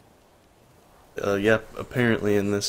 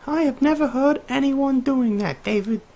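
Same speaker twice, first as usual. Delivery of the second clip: high-voiced scolding wife. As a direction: scold him in a piercingly high character voice. Acting Nora scolding David.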